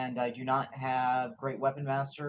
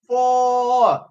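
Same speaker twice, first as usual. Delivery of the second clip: high, loud, drawn-out call; golf yell; 10 semitones higher, 12 dB louder than usual. Acted. Player voices Sven yelling out as the skull flies, golf yell. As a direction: yell it out like a warning across the course. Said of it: "Sven yells out".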